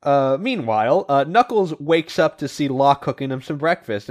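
ordinary talk, nothing marked

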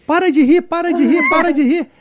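high, loud repeated begging; insistent high-pitched mock plea; imitated urgent plea. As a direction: mimic someone pleading for the laughter to stop. Retells passenger begging him to stop.

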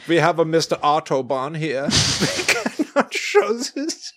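put-on German accent for a joke; mock German accent. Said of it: "Why are you doing that accent".

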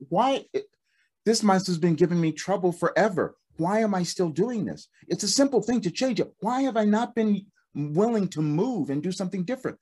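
mock-fed-up with yourself; retold exasperation. Retold exasperation at broken mouse.

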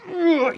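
high, loud strained growl; mock angry growl. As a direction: growl with effort while grabbing. Acts the grab of an exploding woman. (gruñido agudo de furia fingida)

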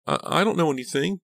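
very high, loud, frightened stammer. A narrator's reading. Dina with a gun pointed at her, stammering. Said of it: "Dina stammered".

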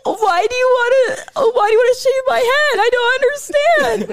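loud, high, wailing laughter; shrieking with laughter; laugh-crying shout. Real, not acted.

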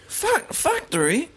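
halting attempt at a British "factory"; mock British accent. Shown as a demonstration. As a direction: have a go at a British "factory", slowly. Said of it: "with a British accent".

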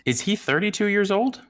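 high, startled disbelief; surprised. Real, not acted. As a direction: jump up in pitch, astonished. Doubts the stated age.

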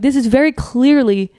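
retold indignant protest; emphatic protest. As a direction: spell it out with exasperated emphasis. Retelling her protest to doctors.

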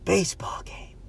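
unvoiced whispered repeat; soft mock whisper; imitated whisper. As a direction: whisper it back like a creepy stranger. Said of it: "he repeats".

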